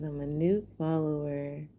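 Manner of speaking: usual speaking voice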